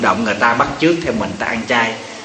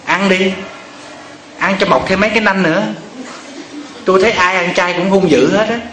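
husband mocking; 5 semitones higher than usual. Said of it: "Thì ông chồng mới nói".

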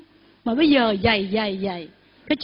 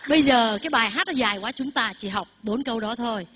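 ordinary talk

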